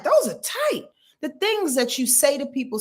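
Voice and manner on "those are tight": complimenting a stranger. Retold or imitated. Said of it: "I'm like".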